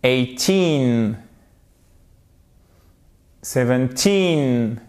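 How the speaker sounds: put-on "Eighteen", "Seventeen" with long falling TEEN; stress on TEEN. Shown as a demonstration. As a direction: slowly lean on the end of "Eighteen" and "Seventeen". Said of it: "c'est la dernière syllabe, le teen, qui est accentué". (accent long sur « teen » dans « Eighteen » et « Seventeen »)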